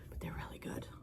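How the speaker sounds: hushed whisper, voice dropped away; soft whispered aside. Real, not acted. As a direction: whisper it like a secret. Whispering about the cookies.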